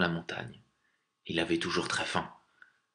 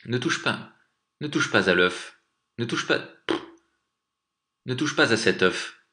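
urgent, insistent warning; forceful but controlled command; pressing voice; 4 semitones higher, 5 dB louder than usual. A narrator's reading. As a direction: press him hard to stop. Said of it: "dit l'homme d'une voix pressante".

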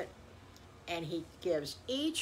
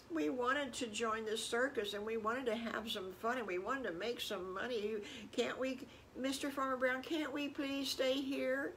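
put-on wistful little-kid plea, pitched up; pleading children; 5 semitones higher than usual. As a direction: beg gently to be allowed something. Children plead to stay in circus.

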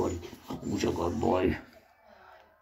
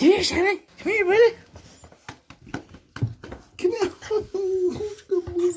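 high-pitched coaxing pet talk; calling the dog; 10 semitones higher than usual. Real, not acted. Owner coaxing the dog over, high and playful.

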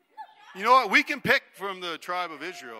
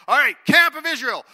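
called out much louder and higher as a cue; loud rallying cue; 6 semitones higher, 10 dB louder than usual. Shown as a demonstration. Leader calling out to kids to cue their scream, loud. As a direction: boom it out so the kids scream back. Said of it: "every time I say Israel Camp".

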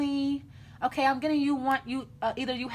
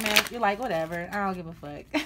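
not caring; 5 semitones lower than usual. Acted. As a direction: toss it off as if you couldn't care less. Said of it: "you don't even care".

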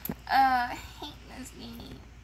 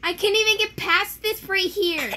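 higher and louder complaint; frustrated outburst; 7 semitones higher, 8 dB louder than usual. Real, not acted. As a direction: complain loudly, stuck and fed up. Stuck on harder level.

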